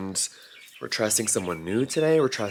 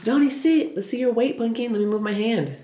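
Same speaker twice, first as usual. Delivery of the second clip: coaxing pet talk; 12 semitones higher than usual. Real, not acted. Owner getting dog weighed, high coaxing voice. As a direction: coax gently in a very high voice.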